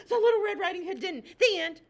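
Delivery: eager chicken bursting into the tale; excited interruption. Acted. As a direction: crash in high and loud.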